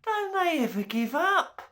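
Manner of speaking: high, small creature moan; a tiny tooth fairy groans in dismay; moaning Milktooth. A narrator's reading. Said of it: "moaned Milktooth".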